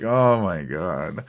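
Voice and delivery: slow, drawn-out sigh of disbelief; exasperated disbelief. Retold or imitated. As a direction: drag it out, exasperated. Reacting to vet bill.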